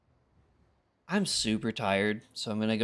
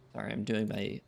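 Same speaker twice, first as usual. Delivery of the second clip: ordinary delivery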